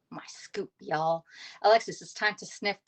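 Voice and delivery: ordinary talk, nothing marked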